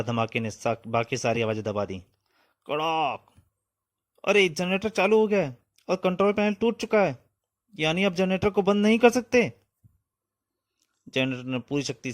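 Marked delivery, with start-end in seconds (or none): 2.7-3.3 higher, drawn-out "kadaak". A narrator's reading. Narrator voices a cracking noise. 4.3-9.5 high, urgent acted alarm; alarmed outcry. Character alarmed at runaway generator.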